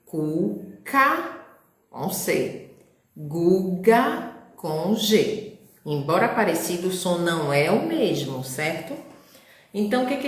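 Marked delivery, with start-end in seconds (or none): 0.0-1.4 Cuca said carefully with hard K sounds; word demo. Shown as a demonstration. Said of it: "Observa que uma é escrito com a letrinha C". 3.2-5.6 Guga com G said very slowly and deliberately; slow word demo. Shown as a demonstration. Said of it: "Observa que uma é escrito com a letrinha C".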